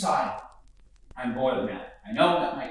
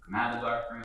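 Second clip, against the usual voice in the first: ordinary talk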